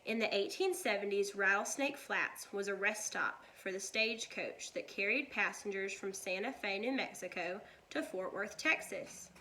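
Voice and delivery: plain reading voice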